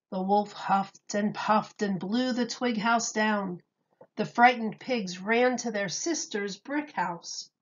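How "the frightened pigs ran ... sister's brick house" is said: ordinary delivery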